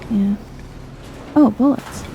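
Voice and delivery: ordinary delivery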